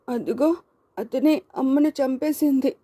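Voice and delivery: put-on high-pitched boy crying out an accusation; accusing child. A narrator's reading. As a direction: point out the killer in a distressed child's voice. Crying boy points out mother's killer.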